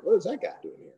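high, surprised double take; mock surprise. Pastor imitating referee, high surprised question. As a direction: play the puzzled referee spotting him. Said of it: "he comes back like".

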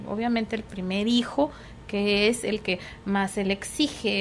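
usual speaking voice

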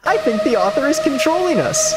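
high, hammed-up alarm; mock panic. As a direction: announce it high in fake dread. Characters panic about the author.

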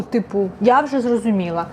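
ordinary delivery

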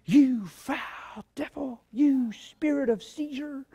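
slow, drawn-out, lightly voiced mock exorcist; mock deliverance prayer. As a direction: make fun of their dramatic casting-out. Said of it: "all the language going on back there".

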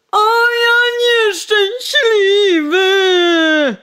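high, loud, crying wail; wailing lament. Acted. Coachman moaning again over spilled wine, crying. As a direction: sob nieszczęśliwy out slowly. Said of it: "jęknął znowu woźnica".

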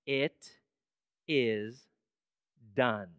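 low, word by word with long pauses; weighty, spaced-out low delivery; solemn divine pronouncement. Acted. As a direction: declare it slowly and gravely, each word alone. Voicing God's declaration from throne.

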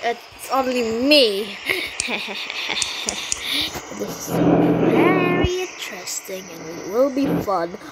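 a light mock villain laugh and lines. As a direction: play the sneaky villain revealing himself. Sinister villain voice.

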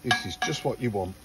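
normal talking voice

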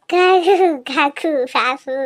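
loud, drawn-out nonsense calls; baby-amusing noises. Acted. Amusing a baby with long, loud playful calls. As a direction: stretch silly syllables loudly for a baby.

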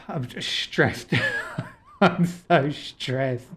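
ordinary delivery

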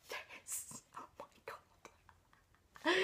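slow, breathless whispered oh my god; awed whisper. Real, not acted. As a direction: whisper it, overwhelmed by beauty. Awed by beautiful book edition.

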